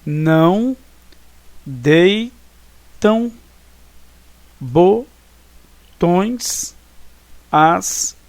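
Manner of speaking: "não deitam botões as" with a long pause after each syllable. Shown as a demonstration. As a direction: scan the verse slowly, each syllable set apart.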